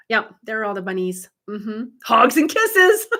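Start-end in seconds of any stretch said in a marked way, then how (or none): 2.0-3.1 sing-song higher, slow sign-off. Real, not acted. Host signing off to a viewer, slow sing-song. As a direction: draw out kisses, higher and slow.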